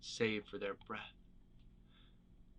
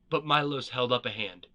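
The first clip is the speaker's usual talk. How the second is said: usual speaking voice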